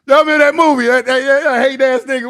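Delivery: real laughter spilling into the words; excited laughing outburst. Real, not acted. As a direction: burst out laughing as the movie comes back. Laughing, recalling a movie scene.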